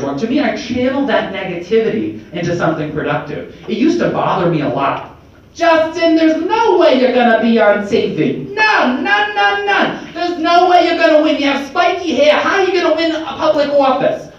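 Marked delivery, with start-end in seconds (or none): 5.5-14.3 high, loud mock Bronx naysayer. Speaker mimicking naysayers who doubted him, high mocking voice. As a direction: put on a squawking high-pitched doubter. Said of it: "I just use that voice for naysayers".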